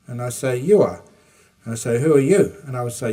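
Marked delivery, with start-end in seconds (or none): 0.6-1.0 a loud teasing retort. Retold or imitated. Replaying debate. 2.0-2.5 a loud teasing retort. Retold or imitated. Replaying debate.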